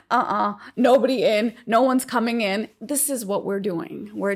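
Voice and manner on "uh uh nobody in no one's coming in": firm refusal. Retold or imitated. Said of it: "it's saying".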